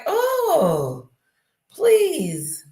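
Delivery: high, hammed-up whiny mock voice; mock whine. Streamer mocking celebrity victims, hammed-up high whine. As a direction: sneer it in a squeaky put-on whine. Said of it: "All they do is whining and crying".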